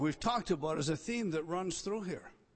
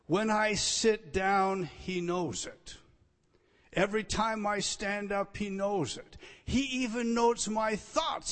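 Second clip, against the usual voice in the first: psalmist's words; 5 semitones higher, 6 dB louder than usual. Acted. Preacher voicing the psalmist.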